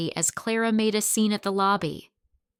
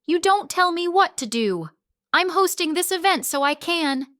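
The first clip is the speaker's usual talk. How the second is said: defiant yell; 11 semitones higher, 6 dB louder than usual. Acted. Clara fighting back in quarrel.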